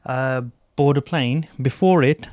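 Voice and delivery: ordinary talk, nothing marked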